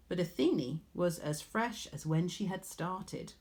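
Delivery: plain reading voice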